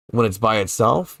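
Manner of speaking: ordinary talk, nothing marked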